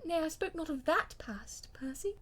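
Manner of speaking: a hint of a wife begging her husband; lightly voiced pleading wife. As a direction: gently correct him, hoping he softens.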